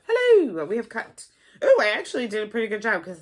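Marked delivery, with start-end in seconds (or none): 0.1-0.6 playful exclamation. Real, not acted. Playful sing-out greeting to project.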